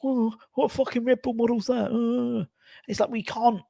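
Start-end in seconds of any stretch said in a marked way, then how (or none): 0.0-2.4 high, scornful fan voice; mock a sneering supporter. Mocking what fans would say about a new signing, higher sneer. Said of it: "people would be like".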